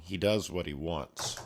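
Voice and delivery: ordinary talk, nothing marked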